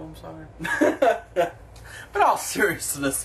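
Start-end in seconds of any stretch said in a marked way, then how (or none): 0.0-1.5 laughing apology. Real, not acted. Laughing apology after outburst.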